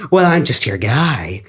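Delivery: a hint of a sly Eggman, drawn out. Retelling the movie, Eggman offering help in a lightly low voice. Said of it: "And then Eggman's like".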